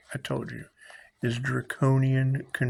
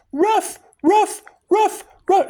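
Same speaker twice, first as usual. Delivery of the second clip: very high, louder yapping barks; dog bark; 6 dB louder, slower than usual. Retold or imitated. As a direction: yap like a small dog. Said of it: "Imagine a bark".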